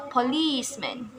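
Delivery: policeman with LICE stressed; policeman, weight on the middle syllable; stress on second syllable. Shown as a demonstration. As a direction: hit LICE in policeman, then fall. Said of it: "stress is placed in the second syllable".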